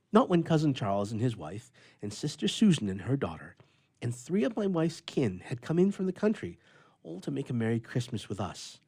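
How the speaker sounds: ordinary delivery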